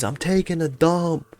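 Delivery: high, drawn-out announcement; announcing shamelessly. Retold or imitated. Announces excuse to whole class.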